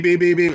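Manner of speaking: loud high beep beep beep; imitated machine beeps. Imitating his IV pump alarm for viewers, loud high beeps.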